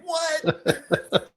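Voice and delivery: shrieked high what through a laugh; mock shocked squeal; incredulous what. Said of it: "And I'm like".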